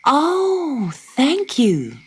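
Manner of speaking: put-on high, eager little girl; grateful cry. Dot thanks the platypus, high and eager. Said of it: "cried Dot".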